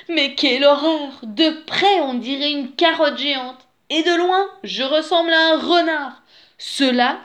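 high, loud cry of dismay; horrified exclamation. A narrator's reading. Wolf covered in orange peel, horrified exclamation. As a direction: exclaim it, aghast at the sight.

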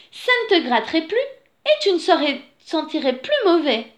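high storybook character voice; lightly voiced she-bear. A narrator's reading. She-bear persuades the dirty bear to wash, lightly voiced character.

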